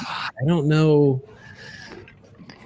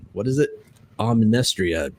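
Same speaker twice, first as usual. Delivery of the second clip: normal talking voice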